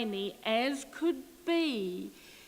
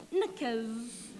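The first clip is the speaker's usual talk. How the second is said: ordinary delivery, nothing marked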